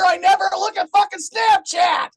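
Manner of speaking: loud, high exasperated shout; fed-up yell. Acted. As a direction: yell it like you've lost all patience. Yelling at wife over Snapchat.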